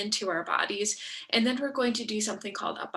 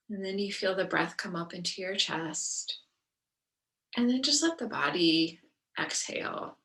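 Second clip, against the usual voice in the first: guided meditation; 4 dB quieter than usual. Acted. Yoga teacher settling the class into breath and body, guided meditation. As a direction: ease them gently into each breath, leaving space between phrases.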